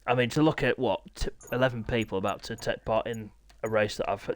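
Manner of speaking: ordinary talk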